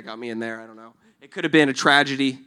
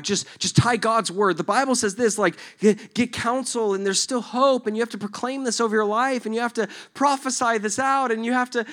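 higher-pitched, insistent urging; earnest encourager; 7 semitones higher than usual. Acted. Friends urging a struggling man, earnest high pleading. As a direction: pile on advice, pitch raised. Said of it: "And then they say".

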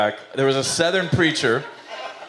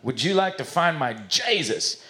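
slow, drawn-out Southern preacher drawl; Southern accent, stretching out the words; 4 semitones higher, slower than usual. Acted. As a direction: play a Southern preacher making an altar call. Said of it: "he's kinda Southern".

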